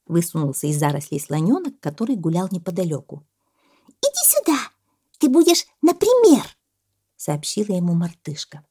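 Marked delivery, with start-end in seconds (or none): 3.9-6.4 very high, loud squeaky summons; bossy monkey. A narrator's reading. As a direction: order a friend over as a monkey. Monkey orders the elephant over. (командует мартышка)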